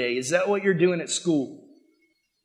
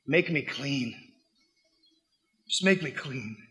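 softer, hushed plea; quiet plea; 4 semitones lower, 8 dB quieter than usual. Acted. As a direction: beg softly to be made clean. Leper begging Jesus for healing.

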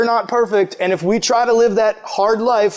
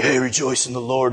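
self-righteous preachy dude; 9 semitones lower, 4 dB quieter than usual. Retold or imitated. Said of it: "dude who comes up and is like".

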